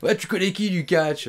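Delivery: loud, high mock challenge; hammed-up pushy promoter demanding an answer; imitated insistence. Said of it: "il insistait".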